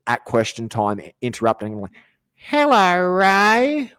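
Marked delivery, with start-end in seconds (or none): 2.3-3.9 very high, sing-song coo; creepy swinger couple. Retold or imitated. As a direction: greet him like a leering fan. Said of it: "this creepy swinger couple".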